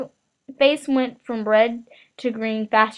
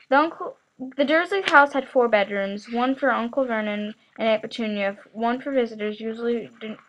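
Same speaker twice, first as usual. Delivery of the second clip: ordinary delivery, nothing marked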